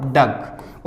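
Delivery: डक said clearly as "duk" with no final t; pronounce duck. Shown as a demonstration. Teacher modelling डक for students, short "duk". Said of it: "ऐसे होगा".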